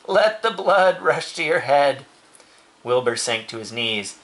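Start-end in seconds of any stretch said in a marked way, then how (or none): none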